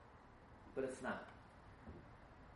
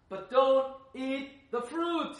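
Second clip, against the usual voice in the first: higher, louder, very slow warning; emphatic shout; 11 semitones higher, 12 dB louder, much slower than usual. Acted. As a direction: boom out the one forbidden thing. God's one command, shouted.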